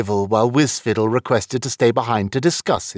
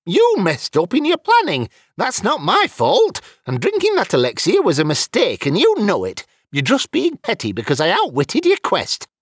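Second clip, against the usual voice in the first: high-pitched indignant wizard; heated, high-voiced self-defence; argumentative; 11 semitones higher than usual. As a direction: argue back and throw the blame across. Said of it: "Wizfiddle argued".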